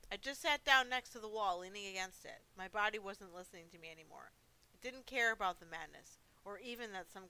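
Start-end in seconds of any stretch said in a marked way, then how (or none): none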